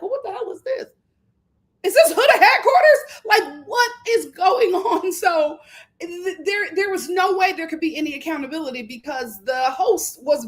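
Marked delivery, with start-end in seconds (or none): 0.0-5.1 high, loud, laughing disbelief; half-laughing, half-outraged; incredulous outburst. Retold or imitated. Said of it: "And I'm like".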